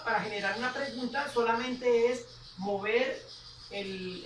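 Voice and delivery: ordinary delivery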